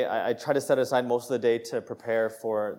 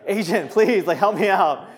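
high, louder plea for help; pleading; 5 semitones higher, 6 dB louder than usual. Retold or imitated. Retelling pleading with the agent for help, high and loud. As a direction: beg the agent to step in. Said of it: "And I was like".

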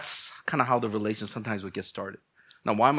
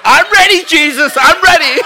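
eager; 12 dB louder than usual. Acted. Congregant eager to be matched.

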